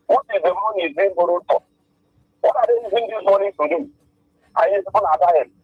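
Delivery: ordinary talk, nothing marked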